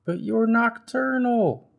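high, slow, whiny protest; little bird complaining loudly; indignant Bird. Acted. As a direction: plead the point like a frustrated child. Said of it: "said Bird".

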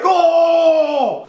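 a high, loud, teasing "Oh!" shout; imitated chorus shout. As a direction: yell it like the whole crowd reacting to a burn. Friends react to a burn.

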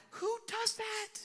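slow, very high squeak; mock disbelief. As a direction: draw it out high in comic bafflement.

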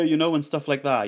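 usual speaking voice

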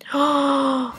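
sharp gasp of shock. Acted. Bridget gasping at Emma's rude words. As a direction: gasp in disbelief.

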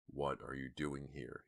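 slow, soft menacing growl; hollow-voiced masked intruder; deep hollow growl. A narrator's reading. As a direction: growl it slowly, keeping it hushed. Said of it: "he growled in a deep, hollow voice".

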